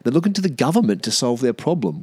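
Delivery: normal talking voice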